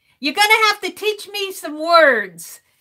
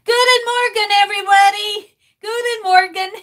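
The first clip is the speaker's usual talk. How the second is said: higher and louder, Guten Morgen sung out to everyone; excited greeting; 6 semitones higher, 4 dB louder, faster than usual. Shown as a demonstration. Streamer greets viewers with new Dutch, excited. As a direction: proudly greet the whole chat. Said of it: "Oh, I can talk Dutch now".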